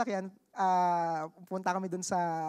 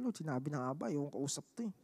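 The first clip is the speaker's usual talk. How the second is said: hushed, muttered to God under the breath; a private word to the Lord, kept low; quiet aside prayer; 7 semitones lower, 10 dB quieter than usual. Retold or imitated. To God in the parking lot, softly. Silent prayer to himself mid-conversation.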